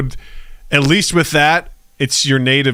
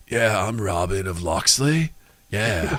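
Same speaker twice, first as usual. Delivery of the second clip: lower, softer, slow dude voice; 6 semitones lower, 6 dB quieter, slower than usual. Retold or imitated. Mocking a Midwest actor, lower, slow and laid-back. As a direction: say it like a chill surfer. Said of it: "It'd be funny if he's like".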